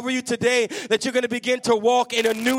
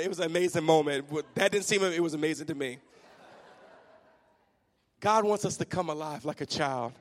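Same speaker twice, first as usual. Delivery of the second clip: ordinary delivery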